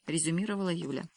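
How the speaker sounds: ordinary delivery, nothing marked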